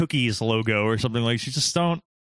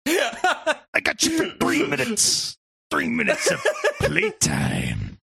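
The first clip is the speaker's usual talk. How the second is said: Randy Savage; 8 semitones higher, slower than usual. Retold or imitated. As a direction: stretch it into a menacing wrestler's promise. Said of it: "Randy Savage".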